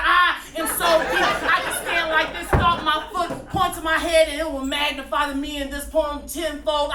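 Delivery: loud, driving spoken-word rant; hammed-up slam-poet intensity; fired-up slam poetry. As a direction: preach the nonsense at full force. Shouts the parody poem.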